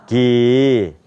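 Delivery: "기구개고" stretched, one syllable at a time; American drawn-out "기구개고"; American-style syllables. Shown as a demonstration. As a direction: model "기구개고" slowly from the throat for repeating. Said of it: "저하고 미국식으로".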